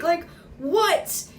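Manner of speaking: high, loud disbelieving what; amazed. Real, not acted. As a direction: react in stunned disbelief. Amazed at one-take shooting.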